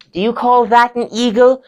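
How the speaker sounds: high, loud affronted drama lecturer; Stevie. Acted. Lecturer criticising student's eagle, high and affronted. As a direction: challenge a student theatrically.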